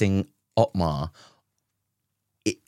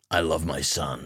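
low, soft doting dad; lightly voiced devoted father; protective father; 4 semitones lower, 5 dB quieter than usual. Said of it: "They gave him a lot of, like".

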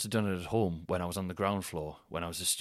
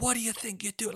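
retold shout; 13 semitones higher than usual. Neighbour calling up to the culprit, high mock shout. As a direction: imitate an angry shout from below. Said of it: "I heard them shout up".

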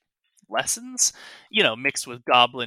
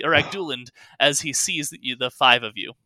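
ordinary talk, nothing marked

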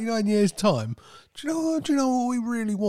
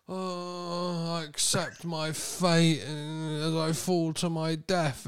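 slow, drawn-out mock acceptance of doom; a hint of theatrical resignation; mock resigned actor; 8 dB quieter, slower than usual. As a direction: play a stuntman calmly accepting his fate. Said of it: "just kinda go".

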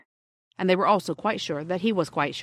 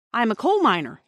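raised, louder insistence; firm; 5 semitones higher, 6 dB louder than usual. A narrator's reading. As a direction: state it as firmly as you can. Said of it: "as firmly as he could".

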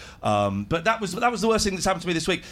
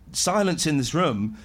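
usual speaking voice

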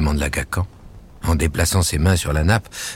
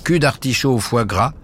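higher, proud read-out of the menu; reading the menu aloud; 6 semitones higher than usual. A narrator's reading. The cook reads her menu aloud to the visitor, proudly. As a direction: announce each dish like a proud cook. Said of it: "Elle lit à voix haute".

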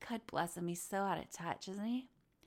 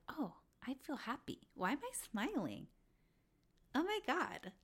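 high, soft voice of dawning joy; hushed, pitched-up delighted wonder; happily surprised; 6 semitones higher, 5 dB quieter than usual. Retold or imitated. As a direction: marvel quietly at your own happiness. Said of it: "I actually feel the joy".

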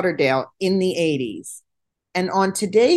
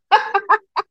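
loud high burst of laughter; 13 semitones higher, 5 dB louder than usual. Real, not acted. Laughs at memory.

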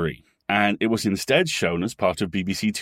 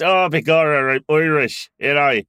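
put-on leprechaun-style Irish accent, higher and louder; hammy fake Irish, begorra; stage Irish accent; 5 semitones higher, 4 dB louder than usual. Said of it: "accent is the evil pyrokinetic".

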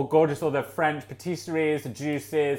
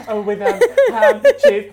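flustered guest; 8 semitones higher, 8 dB louder than usual. Retold or imitated. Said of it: "She was like".

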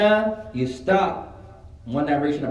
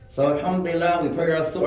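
normal talking voice

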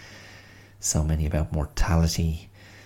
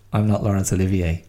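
normal talking voice

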